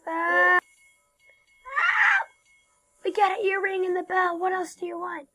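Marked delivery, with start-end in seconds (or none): 0.6-2.3 mock scream. Scared by flooding ship in game.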